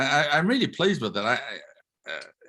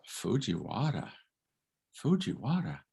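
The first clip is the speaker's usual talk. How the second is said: intrigued recognition; 5 dB quieter than usual. Retold or imitated. Teacher echoing a Japanese student's royal name, intrigued.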